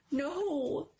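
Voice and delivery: sharp, high cry of no; cried out no. A narrator's reading. Boy crying out to the teacher to keep her away. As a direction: stop her from looking. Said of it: "Charles cried".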